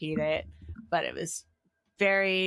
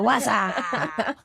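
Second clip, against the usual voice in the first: mock catchphrase; 4 semitones higher, 5 dB louder than usual. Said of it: "my next true calling".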